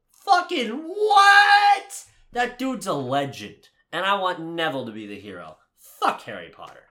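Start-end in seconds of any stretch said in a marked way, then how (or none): 0.1-2.0 drawn-out high shout of disbelief; real astonished yell; incredulous shout. Real, not acted. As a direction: stretch it out, stunned and loud. Amazed at Neville scene.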